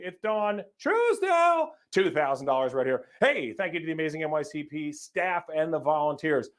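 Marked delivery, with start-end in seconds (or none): 0.0-1.7 high, slow, showy introduction; imitating Dawn announcing herself as she enters; grand self-announcement. Emcee imitating donor Dawn's grand entrance, high slow announcement. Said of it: "she's like".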